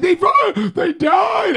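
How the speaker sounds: high shrieking panic; panicked shout. Retold or imitated. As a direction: scream it like a terrified kid. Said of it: "I was like".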